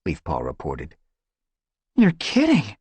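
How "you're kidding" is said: much higher, louder disbelieving cry; astonished at the news; surprised exclamation; gasp of surprise. A narrator's reading. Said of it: "gasped Squirrelpaw".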